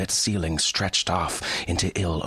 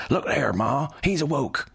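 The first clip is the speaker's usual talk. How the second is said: put-on high, excited backwoods boy; lightly voiced country boy; hillbilly son exclaiming; 9 semitones higher than usual. A narrator's reading. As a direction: call out to Ma like an eager boy. Son's voice exclaiming in story.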